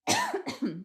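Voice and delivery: high forced throat cough; demo cough. Shown as a demonstration. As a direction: cough on purpose to show the choke. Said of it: "I will cough so you can hear it".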